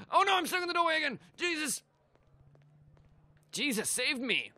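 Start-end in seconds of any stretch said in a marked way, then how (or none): 0.1-1.8 fast, high-pitched fluster; flustered panic. Pretend. Player stuck in a doorway again, fast high panic. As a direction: blurt it high in comic alarm.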